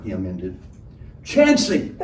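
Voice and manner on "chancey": loud, high tavern-keeper roar. A narrator's reading. Tavern woman roaring for her barman. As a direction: bellow the name across the room. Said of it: "roared the tavern angel".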